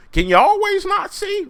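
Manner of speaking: very high, loud mock cop question; high, loud hammed-up officer. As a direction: squeal the question at him. Police asking.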